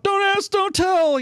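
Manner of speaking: mock holler. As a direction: holler it from across the barracks. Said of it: "somebody's hollering out".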